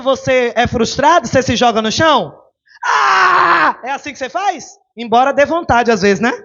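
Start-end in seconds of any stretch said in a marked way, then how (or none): 2.8-3.8 high, loud pretend tantrum shout; hammed-up toddler tantrum cry; mock tantrum wail. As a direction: wail like a child throwing itself on the floor.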